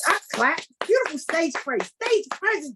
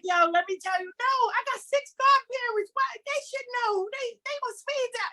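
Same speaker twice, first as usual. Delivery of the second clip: mock bragger; 9 semitones higher, 4 dB quieter than usual. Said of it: "Somebody was like".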